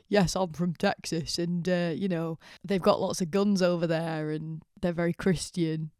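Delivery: mock heavy Yorkshire accent; thick Yorkshire accent. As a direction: imitate a man with a broad Yorkshire accent, slowly. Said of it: "the thickest Yorkshire accent".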